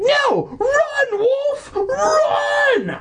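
extremely high, hammed-up screaming; desperate shouting. Boy urging his dog to escape, screaming. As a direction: shriek each word as a frantic plea. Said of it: "I shouted".

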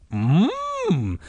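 high muffled hum through a gag; urgent closed-mouth moan; gagged cry. A narrator's reading. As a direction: struggle to yell with mouth taped shut. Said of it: "struggling to talk through the gag".

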